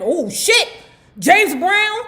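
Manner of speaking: high, loud burst of alarm; startled panic. Acted. Storyteller acting a woman startled by a big name, high loud alarm. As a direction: blurt it out in rising shock. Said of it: "She's sweating".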